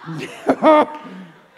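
high, loud laughing shout of fire; ecstatic shout. Real, not acted. Preacher erupting in joy before the congregation, high and loud.

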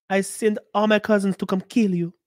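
normal talking voice

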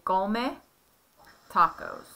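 "Come tacos" spoken clearly in Spanish; careful Spanish modeling; Spanish model phrase. Shown as a demonstration. Parent teaches the he/she form in Spanish. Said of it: "you would say".

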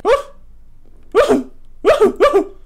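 short, high loud hoots; high spooked woos; repeated whoops. Real, not acted. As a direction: whoop in startled bursts. Spooked whooping at game figure.

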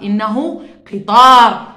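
قطار punched loud and slow; stressed word. Shown as a demonstration. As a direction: hit قطار loudly for the class. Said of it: "في إله عربات". (تشديد على قطار)

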